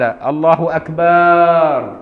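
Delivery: أكبر stretched into a long loud "akbaaar"; lengthened ba. Shown as a demonstration. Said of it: "وعدم مد باء أكبر".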